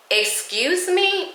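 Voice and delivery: high, slow, incredulous "Excuse me?"; shocked disbelief. Retold or imitated. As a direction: stretch it out in disbelief at the screen. Disbelief at the delayed flight time.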